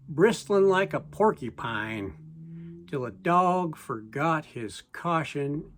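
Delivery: plain reading voice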